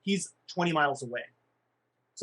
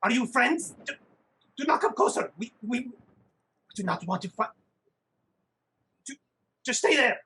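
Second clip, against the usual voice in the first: nervous gnome voice; 5 semitones higher than usual. Acted. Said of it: "gnome speaking in character".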